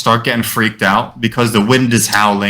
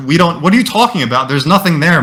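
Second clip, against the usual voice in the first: higher-pitched feigned bafflement; mock disbelief; 6 semitones higher than usual. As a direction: play dumb, insist it's nothing. Acting out feigned ignorance to friend.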